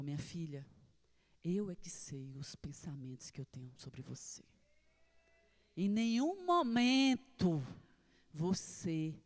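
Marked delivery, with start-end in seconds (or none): 0.0-4.4 lower, soft whisper; whispered voice of God. Retold or imitated. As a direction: breathe God's words, much softer. God's quiet answer to her. (sussurro baixo da voz de Deus)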